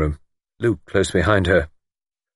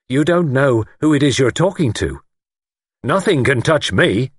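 raised, emphatic self-importance; lofty boast; 7 semitones higher, 4 dB louder than usual. A narrator's reading. Lord tells young man he is untouchable, lofty. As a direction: talk down to him with total certainty.

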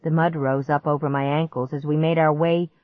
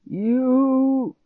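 high, loud, very slow strangled voice; choking monster; 8 semitones higher, 6 dB louder, much slower than usual. A narrator's reading. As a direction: force the words out, choking. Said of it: "he choked out".

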